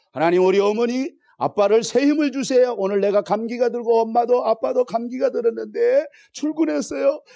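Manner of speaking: put-on loud, high, fervent child's prayer; child's prayer, preached. Preacher voices a child praying for tired parents, loud. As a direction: pray like a small child, shouting it up. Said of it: "기도하는 게 어린아이도".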